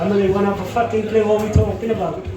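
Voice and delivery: ordinary talk, nothing marked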